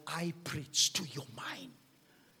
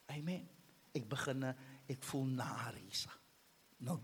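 normal talking voice

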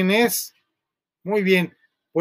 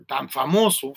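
ordinary talk

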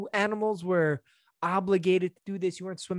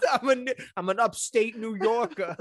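higher-pitched, laughing local-pride declaration; laughing mock excuse; 6 semitones higher than usual. Joking as an upstate guy excusing his behavior, laughing mock protest. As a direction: protest your hometown innocence while cracking up.

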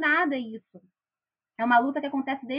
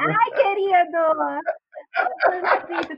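mock grief; 5 semitones higher, 6 dB louder, much slower than usual. Mock mourning to dead character.

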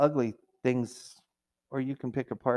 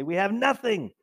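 higher, whiny; lightly mocking grumble; mock complaint; 7 semitones higher than usual. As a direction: whine over a full pantry. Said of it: "you say".

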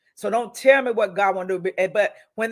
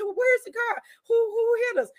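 dazed asking; 13 semitones higher than usual. Retold or imitated. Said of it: "I said".

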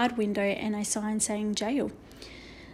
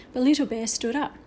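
ordinary delivery, nothing marked

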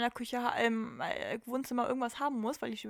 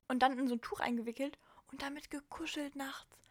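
hushed confiding whisper; 6 dB quieter than usual. Real, not acted. Host confessing to co-host she cuddles a cold pack, whispering. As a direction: whisper it like a small secret.

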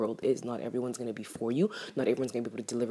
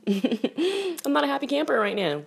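high, loud, laughing complaint; amused exasperation, pitched high; laughing through annoyance; 12 semitones higher, 6 dB louder, slower than usual. Real, not acted. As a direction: laugh off being pissed, drawing it out slowly. Laughs while admitting being pissed off.

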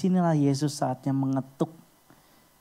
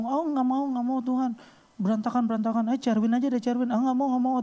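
high-pitched mock refusing; panicky refusal; 9 semitones higher than usual. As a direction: wave the guest off in a high voice. Acted refusals of Jesus as guest. (menolak, nada tinggi)

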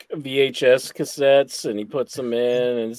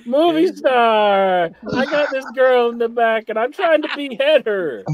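high put-on voice, stretching out "movie star"; store owner impression; 6 semitones higher than usual. Store owner asking movie star to help behead, high mock voice. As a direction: plead for help in a high caricature. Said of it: "If you call Jack movie star".

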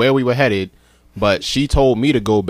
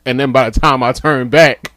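normal talking voice

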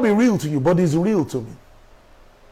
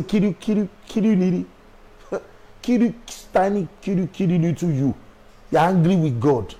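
put-on teasing baby talk; 3 semitones lower, 4 dB quieter than usual. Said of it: "My daughter will say".

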